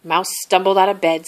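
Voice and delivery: ordinary delivery, nothing marked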